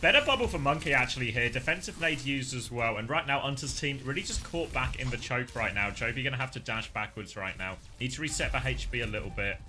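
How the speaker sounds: rapid live play-by-play call. Acted. Live casting the match.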